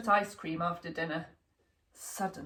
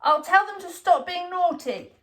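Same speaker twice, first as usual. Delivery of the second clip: put-on little boy promising; 8 semitones higher, 4 dB louder than usual. A narrator's reading. Child promising.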